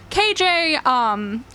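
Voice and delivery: usual speaking voice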